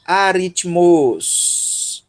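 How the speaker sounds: Aritmos drawn out very slowly, stress on rit; slow model word. Shown as a demonstration. Teacher prompting students with Aritmos slowly. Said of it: "Alguém consegue recuperar?".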